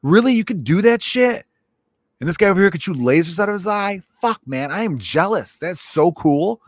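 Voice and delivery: amazed fan. Retold or imitated. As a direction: sound starstruck by their powers. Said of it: "I'd be like".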